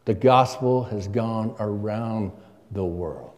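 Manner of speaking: ordinary delivery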